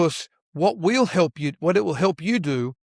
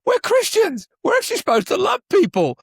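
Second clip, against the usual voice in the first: high, louder sudden realization; high, loud startled realization, hanging on a minute; dawning realization; 4 dB louder than usual. Retold or imitated. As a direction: catch yourself mid-thought in surprise. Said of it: "and he wa".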